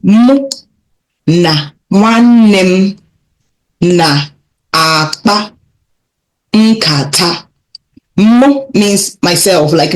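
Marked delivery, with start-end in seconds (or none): 0.0-7.4 slow, word by word with pauses; syllable-paced teaching read; slowly. Shown as a demonstration. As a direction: break it into slow chunks for learners. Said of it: "Let's take it a little bit more slowly".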